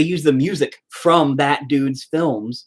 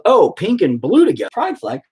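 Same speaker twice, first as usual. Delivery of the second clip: higher, lightly mocking gasp; mock excited fan; 6 semitones higher, 3 dB louder than usual. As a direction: parody a fan spotting a pride flag. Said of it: "Like, nobody goes".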